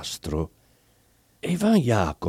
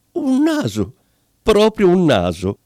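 much higher, gasping repetition of the word; shocked disbelief; 7 semitones higher than usual. Acted. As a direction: exclaim it in horror. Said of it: "si sentì cascare le braccia". (incredulo, con la voce che sale di colpo)

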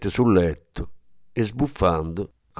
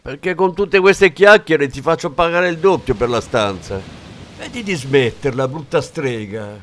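angry yelling; 7 semitones higher than usual. Acted. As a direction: keep shouting her down. Tania keeps yelling at old woman. (Tania urla rabbiosa alla vecchia)

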